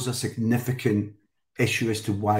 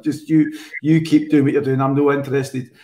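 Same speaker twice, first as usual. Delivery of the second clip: louder, fed-up mate telling him to back off; irritated loud brush-off; 4 semitones higher, 8 dB louder than usual. Retold or imitated. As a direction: push them away: leave me out of it. Mate refusing to be involved.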